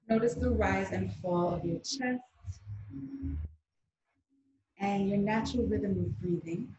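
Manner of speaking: calm meditation guiding with long pauses; guided meditation. Acted. Guide asking group to close eyes and breathe, meditative. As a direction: ease listeners into the breath, phrase by phrase.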